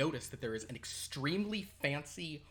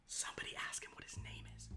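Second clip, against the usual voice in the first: soft unvoiced whisper; whispered aside; 14 dB quieter, faster than usual. Real, not acted. Player whispering to the others, soft and unvoiced. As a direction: lean in and hiss it to the table.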